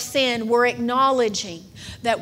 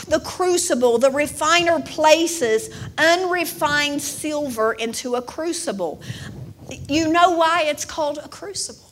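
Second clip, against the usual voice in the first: ordinary delivery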